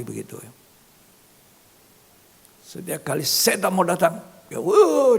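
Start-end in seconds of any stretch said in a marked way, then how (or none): 2.7-5.2 louder and higher, building to a cry of wuh; dramatic outburst. Acted. Preacher acting out the young man's terror, loud cry. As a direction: burst out as the fear hits.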